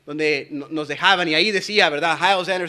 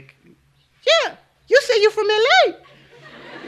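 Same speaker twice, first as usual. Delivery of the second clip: high, drawn-out falsetto insistence; mimicked coworker; 6 dB louder, much slower than usual. Coworker insisting he said L A, mimicked in slow falsetto. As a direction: put on a loud, high coworker voice. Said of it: "Ustedes saben cómo van ... los morenitos".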